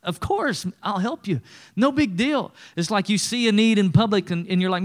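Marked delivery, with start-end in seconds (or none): 0.0-2.5 high, bubbly giggling yes; laughing cheerfully. Pretend. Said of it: "You, like, start laughing".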